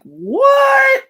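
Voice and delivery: high, loud, drawn-out "What?"; hammed-up shocked "What?" up high; mock indignant what. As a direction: throw back an outraged "What?". Imitating indignant person.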